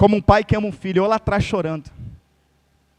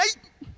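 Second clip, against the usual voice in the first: mock yelp; 11 semitones higher, 7 dB quieter than usual. Voices the struck sheep.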